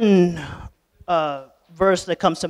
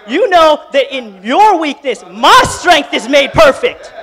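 higher and louder, pleading with Peter; rising fervent Jesus voice; 6 semitones higher, 8 dB louder than usual. Acted. Jesus reaching out to sinking Peter, fervent and rising. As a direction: lift it, pour grace into each line.

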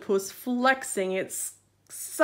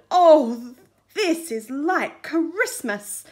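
delighted; 4 semitones higher, 8 dB louder, slower than usual. Acted. Crab delighted at treasure.

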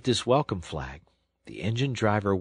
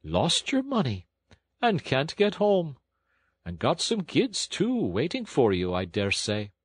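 musing working man, pitched up; reflective engine driver; 6 semitones higher than usual. A narrator's reading. Engine driver weighing washerwoman's plight, reflective and sympathetic. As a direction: think aloud in sympathy, voice lifted. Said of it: "said the engine driver reflectively".

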